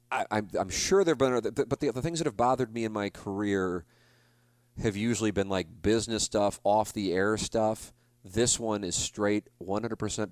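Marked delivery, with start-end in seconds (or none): none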